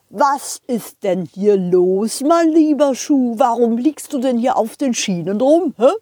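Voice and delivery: lightly voiced scolding driver; train driver voice.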